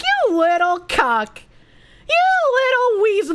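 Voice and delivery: drawn-out playful contempt; hammed-up name-calling; mock scorn. Acted scornful retort to a creator.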